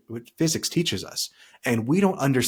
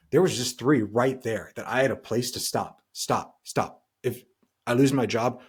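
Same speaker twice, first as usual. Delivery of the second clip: usual speaking voice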